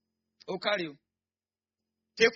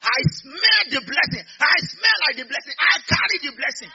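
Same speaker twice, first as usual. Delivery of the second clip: ecstatic shout; 10 semitones higher, 11 dB louder than usual. Real, not acted. Preacher shouting his blessing.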